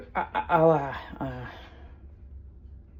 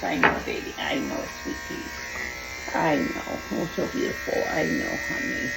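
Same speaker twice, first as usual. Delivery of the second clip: soothing dog; 6 semitones lower, 5 dB quieter than usual. Real, not acted. Soothing the dog during clipping.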